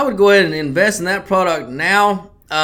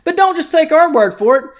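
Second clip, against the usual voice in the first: cheesy radio commercial voice; ad read. Acted. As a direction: sell it like a late-night ad.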